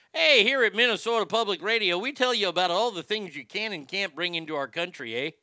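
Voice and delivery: mock Canadian radio voice, eh; Canadian accent. Mocking Minnesota Public Radio, light Canadian accent. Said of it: "went Canadian".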